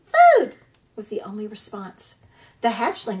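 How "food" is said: high, loud little chick cry; peeping gosling. Acted. Newborn gosling calling to the robot, high peeping cry. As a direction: peep it out like a hungry baby bird.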